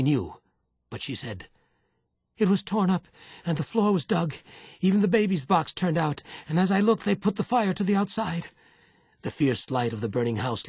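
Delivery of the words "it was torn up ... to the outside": high, loud, frightened outcry; frightened woman blurting out what happened; terrified. A narrator's reading. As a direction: report the disaster in alarm, pitched up and loud. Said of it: "Juana's eyes were wide and terrified".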